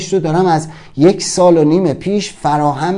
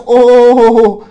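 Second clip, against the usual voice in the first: high, loud, drawn-out "ooooh"; mock-stunned long howl of "oh"; long shocked "oh"; 7 semitones higher, 6 dB louder, much slower than usual. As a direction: stretch out a big reacting "oh". Reacts to the retold heckle.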